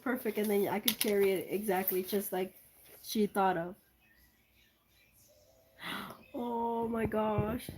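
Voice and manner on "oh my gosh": slow, breathless gasp of awe; drawn-out awed oh my gosh; gasping surprise. Real, not acted. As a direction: gasp it out, stunned by the gift. Gasping at an opened gift.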